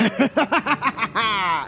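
high, mad villain's laugh; maniacal laugh. Acted. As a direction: cackle like he's losing his mind. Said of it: "starts laughing at you maniacally".

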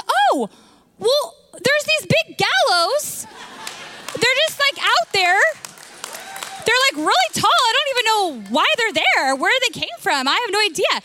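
high-pitched, sing-song mock-innocent bystander; feigned innocence. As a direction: play the oh-so-casual informant. Acted bystander feigning innocence about gallows.

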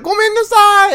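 high-pitched cutesy anime voice; high squeaky quote. Retold or imitated.

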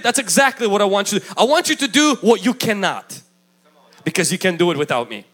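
usual speaking voice

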